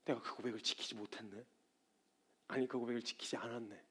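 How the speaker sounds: low, soft admission; humble acceptance. Acted. Believer admitting a broken confession, quiet and humble. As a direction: own up quietly, almost to yourself. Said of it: "그냥 겸허히 받아들여요".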